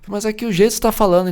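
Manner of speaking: put-on child and mother quarreling over tone; mock argument. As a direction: argue back like a hurt kid.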